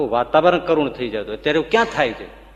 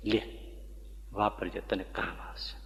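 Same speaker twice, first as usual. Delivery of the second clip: much softer, lower, like a loving grandmother; tender comfort; 7 semitones lower, 16 dB quieter than usual. Retold or imitated. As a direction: hush down and console her gently. Surajba hands over coins.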